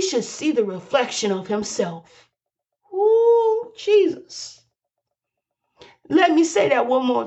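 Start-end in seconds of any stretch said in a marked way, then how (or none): 2.9-4.6 high, ecstatic ooh jesus; a rapt preacher's ooh jesus; excited exclamation. Real, not acted. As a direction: cry out caught up in the spirit. Preacher overcome mid-sermon.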